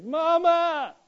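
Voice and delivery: high, loud wailing scream; child screaming for mama. Retold or imitated. Little boy with a stubbed toe screaming for his mother. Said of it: "I screamed".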